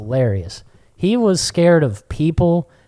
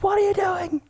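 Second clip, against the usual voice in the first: high, strained mock yell without much volume; retold yelling; 4 dB quieter than usual. Owner to puppy just pulled aboard, mock yelling. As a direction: scold the puppy in a high pitch. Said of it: "like, yelling at Puppy".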